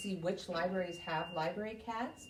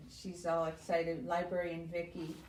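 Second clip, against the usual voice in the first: ordinary talk, nothing marked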